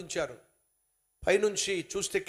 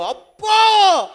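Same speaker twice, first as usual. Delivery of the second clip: high, loud cry of delight; high, loud, drawn-out cry of glee; delighted gasp; 13 semitones higher, 15 dB louder, much slower than usual. Acted. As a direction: shout with sudden joy at the gold. Passerby finds gold bangle.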